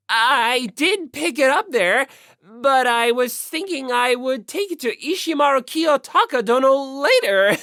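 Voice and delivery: overly formal and flustered; pompous otaku. Acted. Yamada answering Naegi from the computer, loud pompous otaku voice.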